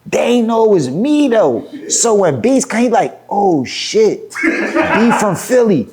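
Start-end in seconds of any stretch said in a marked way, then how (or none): 3.3-5.9 higher-pitched surprise, drawn out at the end; retold startled recognition. Rival spotting the Philly rapper, surprised. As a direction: imitate someone suddenly recognising a rival.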